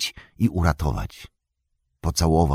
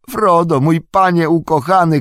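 much higher, louder, crying out through tears; tearful cry; 13 semitones higher, 7 dB louder than usual. A narrator's reading. Sam finding his beloved master at last, tearful shout. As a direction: break into a desperate sobbing cry. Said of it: "krzyknął Sam, nie widząc prawie nic przez łzy".

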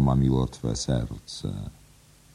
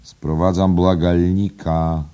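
slow, lilting and louder; melodiously; 5 semitones higher, 8 dB louder, slower than usual. Acted. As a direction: announce it with a drawn-out sing-song lilt. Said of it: "powiedział melodyjnie".

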